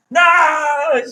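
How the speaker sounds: sudden high, loud cry of dismay; frustrated shout. Real, not acted. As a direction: yell at the broken calculator. Calculator erases data, teacher reacts.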